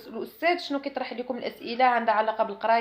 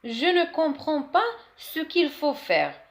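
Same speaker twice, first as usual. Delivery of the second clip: slow, higher classroom French; slow model sentence; 5 semitones higher, much slower than usual. Shown as a demonstration. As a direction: say it slowly for pupils to copy. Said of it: "model phrase". (جملة نموذجية ببطء)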